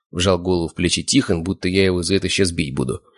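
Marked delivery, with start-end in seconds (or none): none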